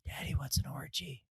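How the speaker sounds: whispered imitation of a curious kid; soft, voiceless whisper of an asking child; child's whisper. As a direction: whisper it into dad's ear like a little boy. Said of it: "whispers into me".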